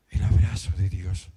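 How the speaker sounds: low, hushed and reverent; deeply moved testimony. Real, not acted. Preacher recalls feeling God's embrace, deeply moved. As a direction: tell it tenderly, voice dropped low.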